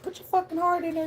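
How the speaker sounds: high mock-insistent bossy voice; pushy voice. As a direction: shove the scared self forward. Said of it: "And then they're like".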